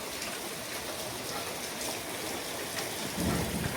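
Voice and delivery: long breathless gasp of shock; startled gasp. Real, not acted. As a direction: gasp as the phone slips. Phone almost falls.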